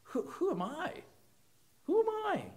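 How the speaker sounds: rising, high anxious asking; Moses doubting. Retold or imitated. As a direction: ask it as a frightened, small man. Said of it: "That's exactly what Moses said".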